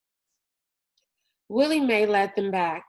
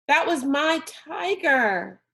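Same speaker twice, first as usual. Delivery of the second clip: slow, high, loud grief; anguished protest; 6 semitones higher, 3 dB louder than usual. A narrator's reading. Rob protesting to his father over his tiger, slow and anguished. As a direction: stretch each word in anguish.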